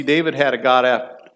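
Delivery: ordinary delivery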